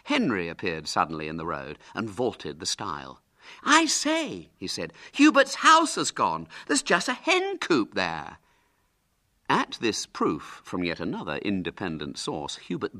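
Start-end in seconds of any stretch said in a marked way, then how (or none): 3.6-4.5 high, loud exclamation; alarmed announcement. A narrator's reading. Henry arrives with news. 5.1-8.4 high, loud announcement; feigned alarm. Pretend. Henry announces the news.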